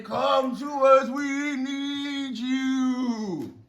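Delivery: high, long-drawn wailing plea; frenzied plea. Acted. Crazed prophets begging Baal to come, drawn-out wail. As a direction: stretch the last words into a high wail. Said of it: "they go even more crazy".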